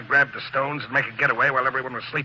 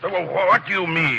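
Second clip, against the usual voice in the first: slow, stumbling start; flustered stammer; 5 semitones higher than usual. Acted. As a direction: trip over the first words, caught out. Caught out, stammering.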